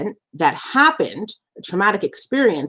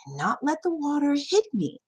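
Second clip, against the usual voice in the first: ordinary delivery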